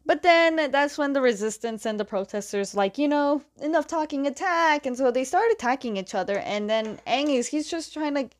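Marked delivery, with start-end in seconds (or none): 3.0-4.9 higher-pitched call to charge; rally the fighters, only half-shouted; mild mock battle cry. Protesters turning on the resistance, mild mock battle cry. Retold protesters launching an attack.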